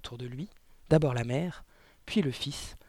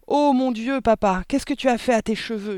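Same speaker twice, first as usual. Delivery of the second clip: high, loud cry of dismay; boy's yell; 8 semitones higher, 7 dB louder than usual. A narrator's reading. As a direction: yell to dad in alarm. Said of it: "hurla le fils". (hurlement aigu du fils effaré)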